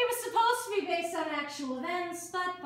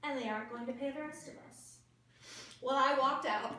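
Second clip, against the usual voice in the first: ordinary talk, nothing marked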